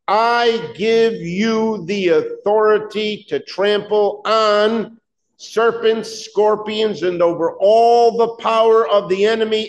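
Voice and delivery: slow, high, deliberate reading aloud; congregational reading. Acted. Pastor reading Luke aloud with the church, slow and high. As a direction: lead the church through scripture.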